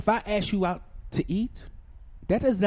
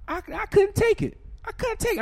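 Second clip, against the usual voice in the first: exasperated. Retold or imitated.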